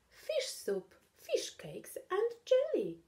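high, eager listing; suddenly hungry and eager. Acted. Charlie now wanting supper.

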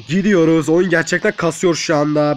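ordinary talk

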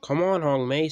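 impatient, high, forceful call; urging shout. A narrator's reading. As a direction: yell at the engine to get moving. Driver urges stuck engine.

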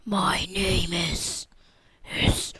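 slow, low breathy whisper; dramatic whisper. Pretend. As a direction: whisper it like a haunted movie opening. Dramatic game intro reenactment.

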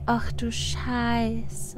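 low, soft and slow dismay; quiet slow swear. Real, not acted. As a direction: mutter it slowly, softly. Shocked at numbers. (leise, langsames Fluchen)

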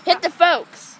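loud Hit the folks at normal pace; loud call. Real, not acted. Caller repeats the command to the player, loud.

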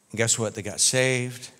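ordinary delivery

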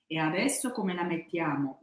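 ordinary delivery

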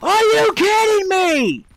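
high, drawn-out cry of disbelief; exasperated outburst. Real, not acted. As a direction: yell it at the screen in frustration. Raging at an online shooter.

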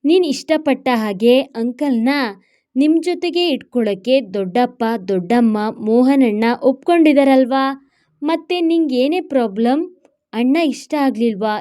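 ordinary delivery

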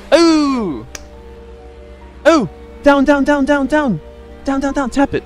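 urgent high-pitched "down" calls; panicked, rapid commands; frantic high shouting. Real, not acted. Frantic game moment.

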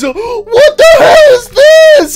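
very high, loud, slow screech; a toy bear screaming at a photo; high shocked shriek. Acted. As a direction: shriek in horror at the picture.

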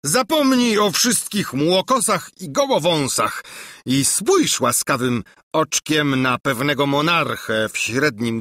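hammed-up amorous monarch; besotted king. A narrator's reading. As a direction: flatter yourself while courting the maid. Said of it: "oszalał z miłości, jak i tamci".

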